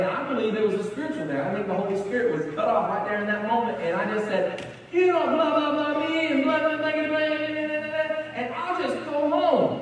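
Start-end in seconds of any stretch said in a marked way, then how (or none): 4.9-9.8 higher, sing-song mock rant; blah-blah through the angry words; heated retort. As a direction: worker ranting at his boss, higher and sing-song. Retold heated argument with manager.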